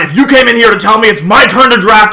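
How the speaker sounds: loud, high-pitched acted tirade; going off. Streamer re-enacts Luke blowing up at him, furious shouting. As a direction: build from the name into a shouted threat. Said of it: "He, like, went off on me".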